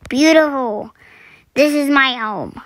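ordinary talk

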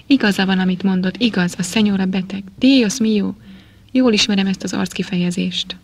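plain reading voice

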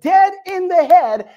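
preacher landing the giant's death, loud; preaching climax. Acted. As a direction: hit it hard. Climax of the story.

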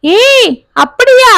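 high, slow stretched gasp of joy; an aunt thrilled by baby news; delighted surprise. A narrator's reading. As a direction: draw it out in happy amazement. Joyful surprise at pregnancy news.

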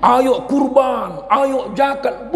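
slow, high, stretched-out urging; mock exhortation. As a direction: call people to give, drawing out each word. Preacher urging people, acted. (seruan ajakan yang dipanjangkan)

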